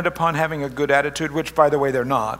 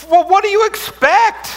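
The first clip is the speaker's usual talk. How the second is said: high, louder incredulous retort; mock exasperation; 14 semitones higher, 6 dB louder than usual. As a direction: toss it back, sarcastic and pitched way up. Mocking reply to reader's complaint.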